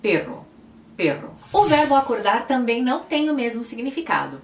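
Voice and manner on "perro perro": "perro" said with a tapped Spanish R; Spanish word. Shown as a demonstration. Teacher repeating the Spanish word for viewers, clear Spanish. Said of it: "você tem que dizer".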